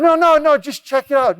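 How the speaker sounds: high, loud, insistent no no no; eager urging. Retold or imitated. Firefighter brothers coaxing a nervous guest, high and loud. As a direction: push him to come along, brushing off his doubts.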